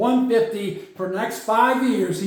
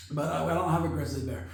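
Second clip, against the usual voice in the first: ordinary talk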